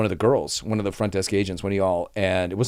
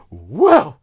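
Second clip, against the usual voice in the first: raised, loud "whoa"; mock shocked guest; 6 semitones higher, 7 dB louder than usual. Guest stunned by the agent's warning, exaggerated shock. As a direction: imitate someone taken aback.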